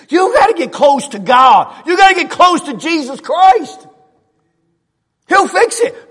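high, shouted exhortation; preaching at full force. Real, not acted. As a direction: cry it out to the congregation.

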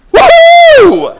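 very high, loud whoop; hilarious giving whoop. Acted. Preacher imagining people giving hilariously, loud joyful whoop. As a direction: whoop like a wildly delighted crowd. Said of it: "people gave hilariously".

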